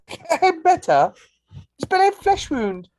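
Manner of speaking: high, slow Monty Python British squeak; high-pitched British accent, Holy Grail style. Pretend. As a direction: ham up a high British knight shrugging off a wound. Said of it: "with a British accent and a high-pitched voice".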